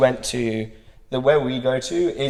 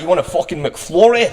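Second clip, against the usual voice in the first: mock aggressive Scottish voice, pitched up; Scottish accent; 6 semitones higher than usual. Mimicking a Scottish guy from a video, aggressive Scottish accent. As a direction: imitate a Scottish guy barking an offer. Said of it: "I saw this guy".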